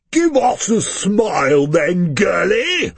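loud, high, slow booming command; booming officious. A narrator's reading. Fat rich diner booms at waitress officiously. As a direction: boom it arrogantly at the waitress. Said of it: "in a booming, officious voice".

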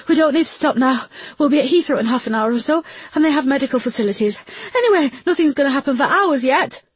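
high, breathless in labour pains; panting. Acted. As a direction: push the words out between pants. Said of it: "panted Rajavi".

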